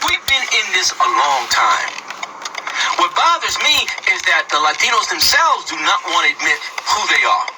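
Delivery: ordinary talk, nothing marked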